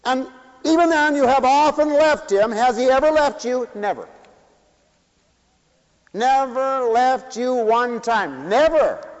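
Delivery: preaching. Acted. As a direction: drive it home to the congregation. Preacher insisting on faithfulness.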